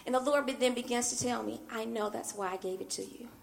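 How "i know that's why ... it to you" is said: soft, hushed fatherly answer; quiet, knowing comfort; gentle reassurance. Retold or imitated. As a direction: reassure tenderly, well under the usual volume. The Lord gently answers her crying.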